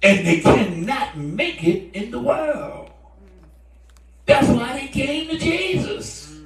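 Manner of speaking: loud, high preacher's delivery with drawn-out words; forceful preaching. Real, not acted. Preacher driving the point home to the congregation, forceful preaching. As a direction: preach it slowly and hard.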